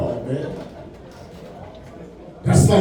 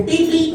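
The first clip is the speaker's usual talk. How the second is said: higher mock horn beeps; 8 semitones higher than usual. Mimicking the truck horn the kids want. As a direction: squeak out a little beep beep.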